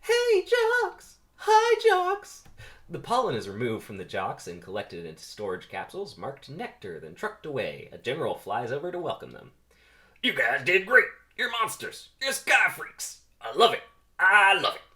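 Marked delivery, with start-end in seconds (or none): 0.0-2.4 very high, loud falsetto fangirl call; shrieky put-on female voice; lady bees calling out. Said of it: "Two lady bees wave at the jocks and call out". 10.2-14.8 loud, high, hammed-up cheering; big shouted congratulations, drawing out words; gung-ho general. General welcomes returning pollen jocks.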